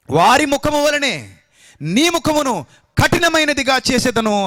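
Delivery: high, loud and slow divine promise; booming proclamation. Acted. Preacher proclaiming God's promise to the prophet, loud and high. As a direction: declaim it like a prophet, slowly.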